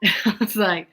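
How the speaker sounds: ordinary talk, nothing marked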